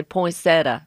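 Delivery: poinsettia said as "poin-SET-a"; Southern pronunciation. Shown as a demonstration. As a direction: say poinsettia without the "ee" before the final a. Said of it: "some Southerners just say poinsettia".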